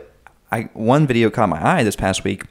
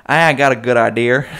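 put-on Southern accent; 3 semitones higher, 6 dB louder than usual. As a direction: say it like a North Carolina local. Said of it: "you hear it in the South".

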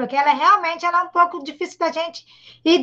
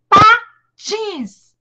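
PATINS broken into pa, pause, tins; split into syllables; 5 semitones higher, 5 dB louder than usual. Shown as a demonstration. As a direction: punch "pa", then draw out "tins". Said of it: "separar essas palavras em sílabas". ("patins" separado em duas sílabas)

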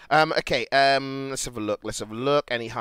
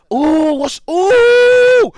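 high, loud, drawn-out whoop; excited "ooh"; 11 dB louder, much slower than usual. Real, not acted. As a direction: whoop up high at juicy gossip. Spots drama on screen.

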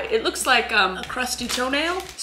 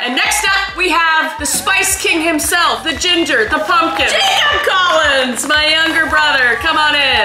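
higher, louder game-show host intro; announcer voice; 6 semitones higher, 9 dB louder than usual. Pretend. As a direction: hype up the guest like an MC.

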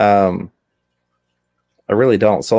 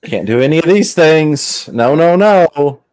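slow and higher, "no, no, no"; mock cautious parent; 8 semitones higher, 4 dB louder, slower than usual. Dad mocking today's overprotective rules, slow and higher. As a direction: drawl the nagging warning.

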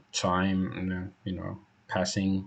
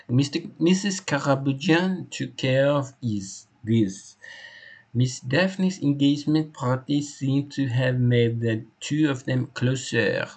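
put-on animated French accent, slightly raised; French-accented loyal servant; 6 semitones higher than usual. Hector telling the inspector how he was hired, French-accented. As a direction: play the devoted French employee giving testimony.

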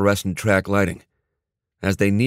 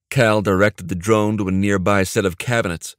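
plain reading voice

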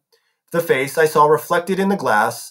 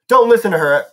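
higher, louder cry of warning; shouted, pitched up to carry; 6 semitones higher, 5 dB louder than usual. A narrator's reading. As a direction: call it out urgently from a distance. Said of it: "Annabeth's voice shouted".

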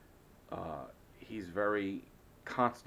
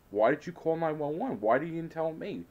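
exasperated disbelief; 8 semitones higher, 5 dB louder than usual. Retold or imitated. Retold exasperated questions to Dad.

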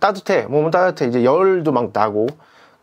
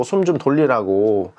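ordinary delivery

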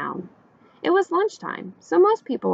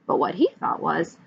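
usual speaking voice

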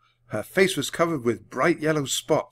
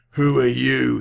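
snarl; 7 dB louder, slower than usual. A narrator's reading. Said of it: "he snarled".

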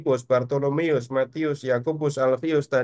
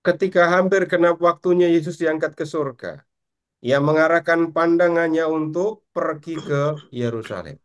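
projected, higher-pitched Bible reading; raised reading voice, measured at the close; scripture reading aloud; 5 semitones higher than usual. Acted. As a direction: read the verses out to the class, slowing on the last words. Reads the Bible passage aloud.

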